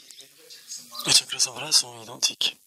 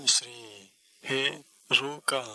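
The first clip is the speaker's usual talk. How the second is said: "Shri Hiruka" with each syllable set apart; slower than usual. Shown as a demonstration. Teacher asking listeners to watch, syllable by syllable. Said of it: "Quatre syllabes".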